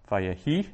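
Vayehi with the last syllable, HI, stressed; stress on final syllable. Shown as a demonstration. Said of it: "I accent that last syllable".

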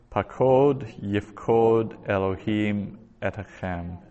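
"Pakod yifkod Elohim etchem" spelled out slowly for learners; slow repeat. Shown as a demonstration. Teacher slowly repeats the verse for students, slowly. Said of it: "slower repeat for learners".

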